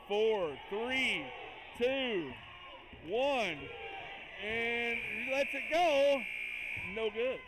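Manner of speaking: loud countdown, stretching the last shot slowly; excited countdown. Real, not acted. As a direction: count the clock down hard, then drag out the release.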